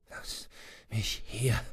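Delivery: faint, laboured plea; with effort. A narrator's reading. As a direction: push the words out with great effort. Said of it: "sagte Swanson mühsam".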